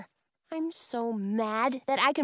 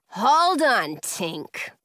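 growled; 7 dB louder, much slower than usual. A narrator's reading. Girl stops Tink slinking away, growling. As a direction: stop her with an angry growl. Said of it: "I growl".

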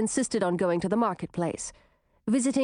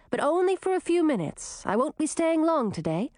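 put-on gentle girl voice; 7 semitones higher than usual. Girl talks to her toys.

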